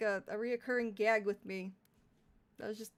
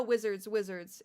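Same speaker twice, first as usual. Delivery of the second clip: ordinary delivery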